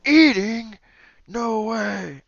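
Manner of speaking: a slimy guttural frog-thing talking; gurgling frog-creature voice. Acted. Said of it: "you hear very gurgling".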